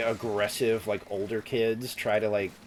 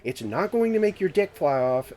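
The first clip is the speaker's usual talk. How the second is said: the expert; 7 semitones higher than usual. Retold or imitated. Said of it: "and he's like".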